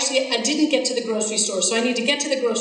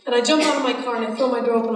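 ordinary talk

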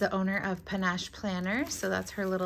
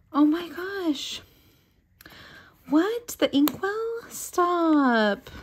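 much higher, louder squeal of delight; squealing excitement; 10 semitones higher, 6 dB louder, slower than usual. Real, not acted. Discovering the inkwell gift, squealing with delight. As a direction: shriek with thrilled disbelief.

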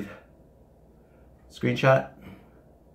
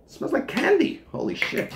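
ordinary talk

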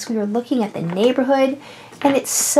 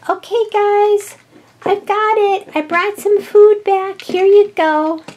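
high, sing-out announcement; announcing; 7 semitones higher, 3 dB louder than usual. Pretend. Food bringer returns to the hungry house, calling out high. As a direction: call out high that you're back.

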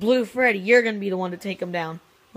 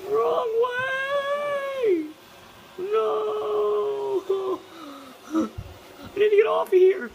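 high puppet voice; 12 semitones higher, much slower than usual. Pretend. Puppet riding, wrong way.

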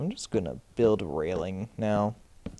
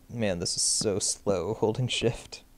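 ordinary talk

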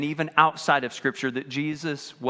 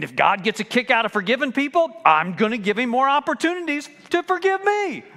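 mock believer; 7 semitones higher, 5 dB louder than usual. Mocking a careless believer.

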